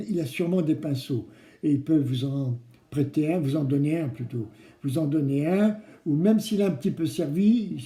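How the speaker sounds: normal talking voice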